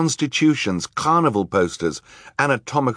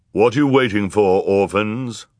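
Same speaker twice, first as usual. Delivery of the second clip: loud, forceful judge's voice; deep-voiced judge; 4 dB louder than usual. A narrator's reading. Judge hurrying the orphans on, deep voice. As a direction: push it out loud and impatient. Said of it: "asked the low, deep voice".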